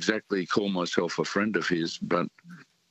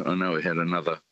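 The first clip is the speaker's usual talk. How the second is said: ordinary talk, nothing marked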